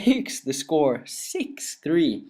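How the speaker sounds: ordinary talk, nothing marked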